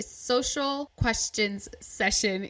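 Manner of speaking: spaced out slowly, word by word; slow, careful articulation. Real, not acted. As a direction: say it slowly to avoid getting tongue-tied. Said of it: "I have to say it slowly".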